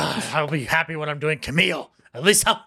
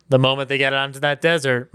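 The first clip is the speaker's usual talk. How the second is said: ordinary talk, nothing marked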